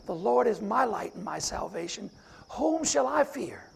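ordinary delivery

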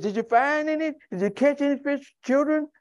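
high, light calling question; voicing Jesus. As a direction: call out to the boat, gently. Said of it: "And said".

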